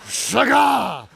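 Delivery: shagah as a fierce shout; with attitude. Shown as a demonstration. Preacher cries the Hebrew word to congregation, with attitude. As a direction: burst out with shagah, full of attitude. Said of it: "say it like that with attitude".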